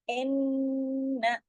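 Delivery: इन्ना with a long nasal ghunna on the double न; slow tajweed recitation. Shown as a demonstration. Teacher showing the ghunna to students, slow. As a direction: hum the doubled न in इन्ना slowly. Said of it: "गुन्ना करना है".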